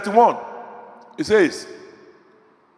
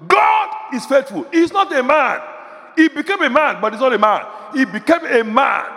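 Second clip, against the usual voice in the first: fervent pulpit declaration; full-force preaching; 4 semitones higher than usual. Real, not acted. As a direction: hammer each phrase home to the congregation. Preaching climax.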